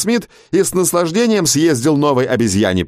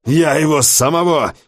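higher-pitched burst of temper; flaring up; 6 semitones higher than usual. A narrator's reading. Sheriff erupts at the prisoner's tale, flaring up. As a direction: snap in sudden irritation. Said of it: "вспылил шериф".

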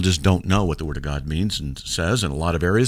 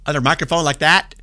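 put-on pitched up high to match the old mic; playful demo of a higher voice; 6 semitones higher than usual. Shown as a demonstration. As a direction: lift the voice up high, a bit strained. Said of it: "I could talk in a higher voice".

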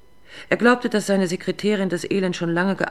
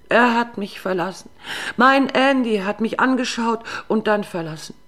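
wailing lament; 6 semitones higher, 5 dB louder than usual. Acted. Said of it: "das heulende Elend".

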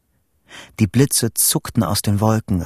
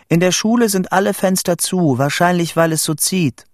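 high, raised voice carrying over distance; calling out; 8 semitones higher than usual. A narrator's reading. Child explaining down a tin-can telephone, calling out. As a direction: call it along the line.

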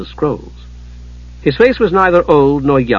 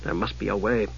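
low, soft mutter; 4 semitones lower, 12 dB quieter than usual. A narrator's reading. Palin to himself at the locked door, muttering. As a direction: mutter it under the breath. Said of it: "he muttered".

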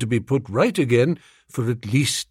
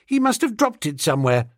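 put-on higher, quick child's voice; offhand schoolgirl; 4 semitones higher, faster than usual. Schoolgirl explaining the found cigarette case, offhand. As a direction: say it lightly and fast as a young girl.

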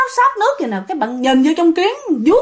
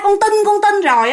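higher panicked plea; frightened cry; 8 semitones higher than usual. Retold or imitated. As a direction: cry out begging in fear. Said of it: "Thì khi mà bạn la".

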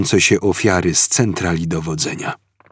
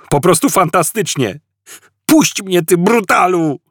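high, angry sarcastic protest; Arthur protesting; 11 semitones higher, 4 dB louder than usual. A narrator's reading. Arthur dragged by the guard, high angry protest. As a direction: fight him off with words.

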